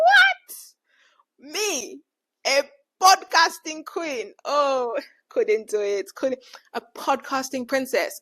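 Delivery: high-pitched loud disbelief, stretched slowly; incredulous. Retold or imitated. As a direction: squeal it as if it could never be you. Mock disbelief at own calling.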